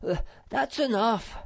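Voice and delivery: a high, breathless gasp; puffed out after the pear throwing. A narrator's reading. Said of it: "gasped Moomin Troll".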